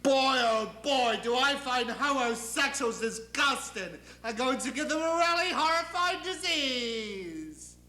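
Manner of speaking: petulant almighty proclaiming his displeasure; God. Acted. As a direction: stretch the words out in grand indignation. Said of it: "And suddenly he says".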